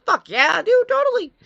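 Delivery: high, loud mock-enthusiastic outburst; loud, squealing hyped-up agreement; over-the-top excitement. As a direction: whoop it out like a thrilled kid, pitch shooting way up. Mock excited reply about free candy.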